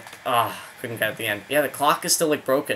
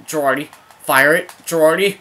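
loud, rising shouts to shoot; excited yelling at the screen; urgent urging; 5 semitones higher, 8 dB louder than usual. Real, not acted. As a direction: call out to the player, building in urgency.

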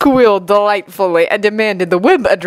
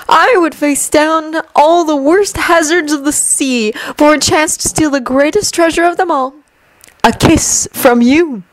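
seductive; 7 semitones higher than usual. A narrator's reading. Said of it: "softly smiling and yet dangerously inviting".

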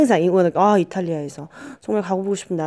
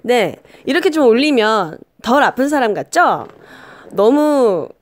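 raised voice tone; 5 semitones higher, 5 dB louder than usual. Shown as a demonstration. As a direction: voice pitched up high and louder, to sound less sick. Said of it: "제가 목소리 톤을 좀 올려봤습니다". (목소리 톤을 확 올려서)

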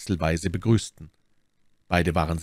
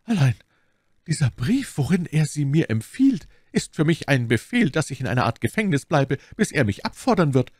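lightly voiced aggrieved lady, pitched up; Milady as victim; 8 semitones higher, 4 dB louder than usual. A narrator's reading. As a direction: explain your plight in a higher voice.

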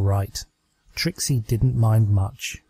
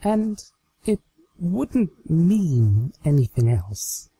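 higher, hesitant and coy; coy Trixie; 5 semitones higher, slower than usual. Acted. Trixie hinting to her doll, higher and coyly hesitant. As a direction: trail off shyly, hinting.

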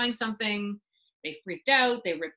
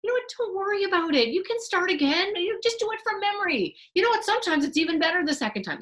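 high, bright reassurance that misses the point; husband brushing off his wife's lost work, high and breezy; breezy cheerful husband; 9 semitones higher, 4 dB louder than usual. Acted. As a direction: chirp it like nothing's wrong. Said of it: "your husband comes in and says".

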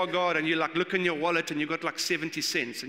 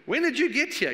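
high, louder mock-innocent question on "you"; sly fake interest; 9 semitones higher, 4 dB louder than usual. Preacher mimicking the crowd's insincere question to Jesus, mock-innocent. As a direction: ask it with a fake, pointed innocence. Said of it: "as if they really wanna know".

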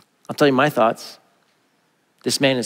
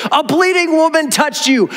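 exasperated teasing; 11 semitones higher than usual. Pretend. Speaker ribbing Jesus over the bleeding woman, incredulous and playful. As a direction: throw up your hands at "bleeding". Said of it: "I just have to giggle at this point because I'm like".

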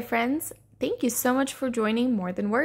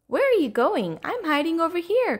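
higher, lightly cried call; 7 semitones higher, 3 dB louder than usual. Acted. Little Blue calling to the seeker, mild cry. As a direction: call to a friend going the wrong way. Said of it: "cried Little Blue".

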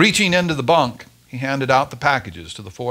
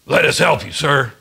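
urgent; 4 dB louder than usual. A narrator's reading. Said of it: "Krakow said urgently".

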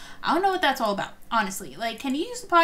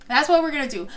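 usual speaking voice

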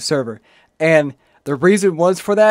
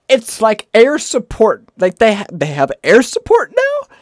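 incredulous; 6 semitones higher, 5 dB louder than usual. Real, not acted. Incredulous about mob.

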